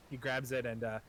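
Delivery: normal talking voice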